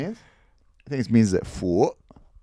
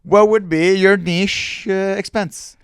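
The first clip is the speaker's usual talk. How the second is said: loud, high, drawn-out segment announcement; big, stretched-out hosting voice; mock show host; 7 semitones higher, 8 dB louder, slower than usual.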